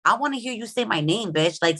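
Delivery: ordinary talk, nothing marked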